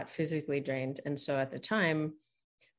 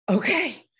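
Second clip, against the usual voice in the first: higher, louder burst of yes; a retold eager okay yes; 9 semitones higher, 8 dB louder, much slower than usual. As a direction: agree with sudden relief. Relieved yes to heart's voice.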